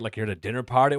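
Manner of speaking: usual speaking voice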